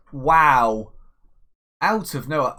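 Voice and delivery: loud, surprised exclamation; stunned wow. Real, not acted. Manager reacts to a shock goal, loud surprise. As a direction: caught off guard by the goal. Opponent scores suddenly.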